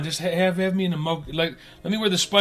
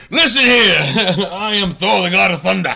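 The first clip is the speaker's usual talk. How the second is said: louder, higher mock thunder god; mock Thor; 6 semitones higher, 9 dB louder than usual. Mocking Thor's grand voice.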